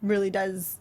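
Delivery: ordinary talk, nothing marked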